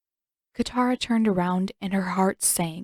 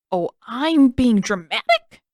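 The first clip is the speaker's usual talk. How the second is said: slow, loud, rising to a squeak on dramatic; squeaky indignation; 4 semitones higher, 8 dB louder, slower than usual. Acted. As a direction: let the voice crack on dramatic. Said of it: "voice squeaked on the last word".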